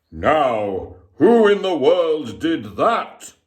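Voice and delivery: startled night watchman; gruff watchman exclaiming. A narrator's reading. Watchman hearing the crash, startled exclaim. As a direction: demand who did it. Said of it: "he exclaimed".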